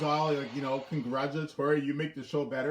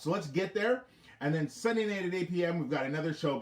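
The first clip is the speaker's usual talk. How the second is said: usual speaking voice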